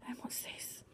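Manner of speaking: hushed whisper. Acted. Storyteller whispers to child over picture. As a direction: breathe it like a discovery.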